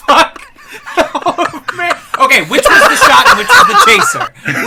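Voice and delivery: loud laughing disbelief. Real, not acted. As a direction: laugh while asking. Laughing reaction.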